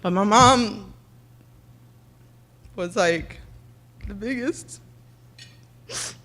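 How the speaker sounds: halting, tearful words about mom; crying. Real, not acted. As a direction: voice catching with real tears. Breaks down thanking her mom.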